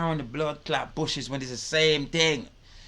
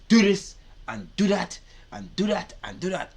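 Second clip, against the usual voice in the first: higher-pitched string of drill orders; army instructor; 5 semitones higher, 4 dB louder than usual. Acted. Army teacher ordering recruits with nothing polite, commanding. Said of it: "whenever they teaching you in the army".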